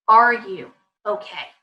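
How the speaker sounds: caring question. Shown as a demonstration. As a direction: ask slowly, handing it over to the child. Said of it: "putting the ball back in their court".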